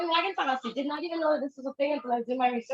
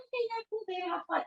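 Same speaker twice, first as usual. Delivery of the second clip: sugary fake approval; 10 semitones higher, 4 dB quieter than usual. Mimicking passive-aggressive partner.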